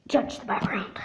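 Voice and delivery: hushed whisper, breath only; quick unvoiced aside. Real, not acted. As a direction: whisper it so nobody hears. Hiding remodeling mess, hushed.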